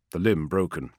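plain reading voice

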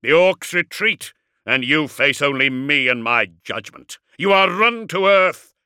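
booming challenge. A narrator's reading. Dreadnought challenges his cornered enemy to come out, booming. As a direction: thunder out a demand for surrender. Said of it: "he boomed".

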